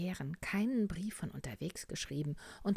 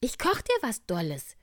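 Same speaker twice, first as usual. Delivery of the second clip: much higher and louder caring little bear; be the eager helpful little bear, pitched way up; a kindly little bear voice, high and loud; 9 semitones higher, 10 dB louder than usual. Acted. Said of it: "sagte der kleine Bär".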